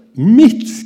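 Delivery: high, loud outraged yelp; shocked yell. Retold or imitated. Ventilation guy protesting the problem is his, yelling. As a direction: shout it back in disbelief. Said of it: "skrek han då".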